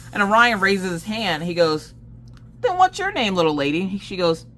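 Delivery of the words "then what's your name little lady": put-on high-pitched sing-song to a little girl; very high kid-directed voice; talking to little kid. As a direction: imitate a teacher sweetly addressing a child. Teacher addressing a little girl.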